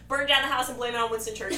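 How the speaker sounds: fast, loud imitated rant, laughing; mock outburst. Quoting film Hitler's plan to a friend, fast mock outburst. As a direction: rattle out the absurd plan loudly. Retells Hitler's absurd counter-proposal.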